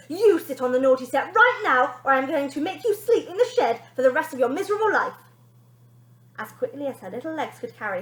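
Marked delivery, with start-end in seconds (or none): 0.1-5.1 high, loud threat; bossy threat. Acted. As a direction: threaten her. Daughter threatening.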